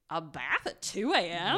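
slow, drawn-out disbelief, rising at the end; incredulous question. Retold or imitated. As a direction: question it as if it were absurd. Retelling her incredulous reaction to story.

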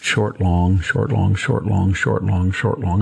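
rhythmic "short, long" chanted evenly; iambic beat. Shown as a demonstration. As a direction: tap out the iambic meter. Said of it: "They're usually an iambic".

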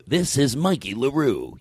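slow, drawn-out name announcement; announcer-style name demo. Shown as a demonstration. Host showing the guest a catchphrase, slow announcer delivery. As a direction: draw out the name like a radio ID. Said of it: "You say it".

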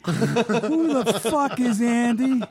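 retold angry outburst, slowed down. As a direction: stretch every word out in disbelief. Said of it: "he's just furious".

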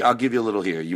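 normal talking voice

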